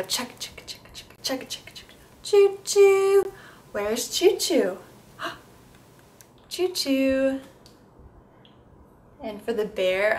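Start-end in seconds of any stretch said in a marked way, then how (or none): 0.0-1.7 soft whispered chugga chugga; whispered train chugging. Shown as a demonstration. Therapist whispering train sounds for a child. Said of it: "I'll take the child's hand and I'll go". 1.7-7.4 high, slow train whistle call; high sing-song choo choo. Shown as a demonstration. Therapist calling choo choo to a child. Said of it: "I'll take the child's hand and I'll go".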